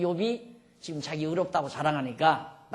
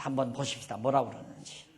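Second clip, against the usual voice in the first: ordinary talk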